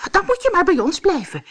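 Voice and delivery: high, fast, loud chorus of little men; dwarfs calling together. Acted. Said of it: "riepen de dwergen tegelijk".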